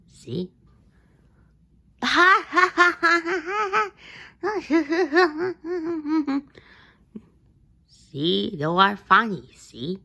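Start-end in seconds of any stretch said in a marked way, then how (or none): 2.0-6.4 high, loud laughing fit; big laugh. Acted. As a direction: burst out laughing. Laughing at the silly word.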